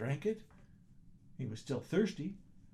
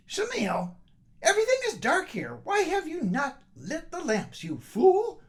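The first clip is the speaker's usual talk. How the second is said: high, shrill-free sharp scolding, louder; scolding wife; 12 semitones higher, 5 dB louder than usual. Acted. Wife home from market scolds husband, high and loud. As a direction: tell off your useless husband.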